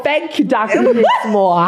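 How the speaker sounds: high laughing mock thanks; mock grateful, laughing. Teasing a friend, laughing mock thanks. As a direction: gush it through a giggle, teasing. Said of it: "You go like this, you go".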